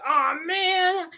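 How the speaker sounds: high, drawn-out mock letdown; mild disappointment. Fan who forgot to ask, retold high-pitched letdown. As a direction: whine lightly at a missed chance.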